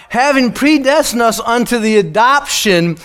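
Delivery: the verse read again with weight; scripture reading. Acted. Preacher rereading the verse to the congregation, with emphasis.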